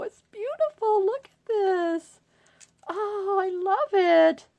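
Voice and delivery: high and loud, slowed admiration; real thrilled gushing; gushing delight. Real, not acted. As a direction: swoon over every detail. Gushing over handmade gift.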